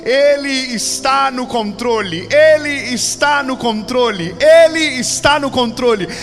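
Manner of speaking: building proclamation. Acted. Preacher rallying the congregation in the storm, building to a shout. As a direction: hammer the refrain up into a shout.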